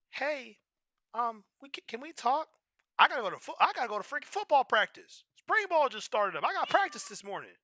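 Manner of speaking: put-on high, pleading girlfriend voice; girlfriend's voice. Girlfriend asking him to talk, high pleading. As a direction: plead to talk right now.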